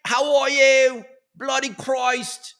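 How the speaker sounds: high, loud British caricature slipping towards Australian; bad British accent. Visitor to England meeting Mort, bad British accent. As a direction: screech it like a hammed-up Brit. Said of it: "with my bad accent work".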